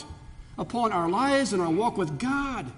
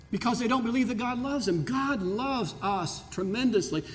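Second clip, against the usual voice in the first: ordinary delivery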